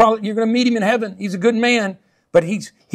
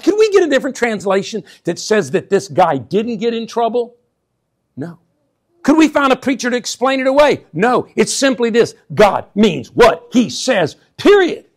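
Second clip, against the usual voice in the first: preaching at full force; 4 semitones higher, 5 dB louder than usual. Acted. Preacher builds to climax.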